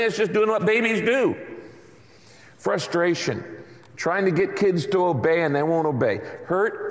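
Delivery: ordinary delivery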